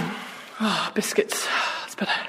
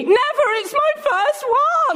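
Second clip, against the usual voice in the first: excited; 13 semitones higher, slower than usual. Acted. Excited protest about first date.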